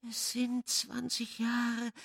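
the ghost voice grieving; lamenting. A narrator's reading. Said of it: "klagte die Stimme".

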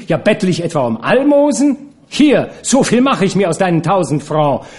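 high-pitched, scornful outburst; indignant yelling. A narrator's reading. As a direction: cry out, insulted by the paltry offer. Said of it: "schrie sie".

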